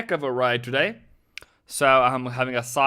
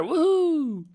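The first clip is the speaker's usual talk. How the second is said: excited woohoo. Real, not acted. Trader cheering his live stream audience, high excited woohoo. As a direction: whoop like your trade just paid off. Cheering as price goes higher.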